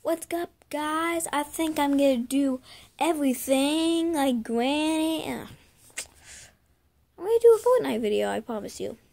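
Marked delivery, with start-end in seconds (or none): 0.0-5.2 high, loud, hyped greeting; YouTuber intro. Pretend. Another intro take. 7.2-8.3 high, loud, rushed shout; excited. Pretend. Excited promise in the intro.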